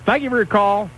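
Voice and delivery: mock-polite hang-up line, over and over; curt brush-off. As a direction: rattle off a fake-polite goodbye to each caller. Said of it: "I'll just hang up on everybody right now and be rude".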